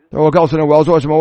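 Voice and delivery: ordinary delivery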